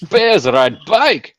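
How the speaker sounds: put-on slow, heavy Russian accent. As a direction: drag it out in a thick Russian accent. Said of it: "That sounds Scottish".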